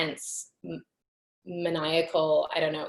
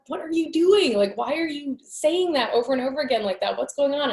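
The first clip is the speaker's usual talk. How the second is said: higher and louder, alarmed questions; 9 semitones higher, 4 dB louder than usual. Retold or imitated. Asking the entity in her mind, alarmed and much higher. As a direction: demand answers in rising panic.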